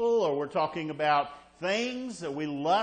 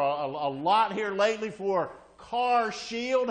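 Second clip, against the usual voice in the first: usual speaking voice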